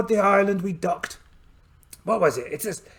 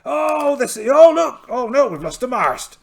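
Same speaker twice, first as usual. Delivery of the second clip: alarmed cry; 3 semitones higher, 7 dB louder than usual. Acted. Pirate captain seeing his mast lost, alarmed cry. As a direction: yelp as the mast goes.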